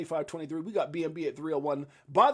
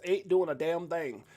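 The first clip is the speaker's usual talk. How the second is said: ordinary delivery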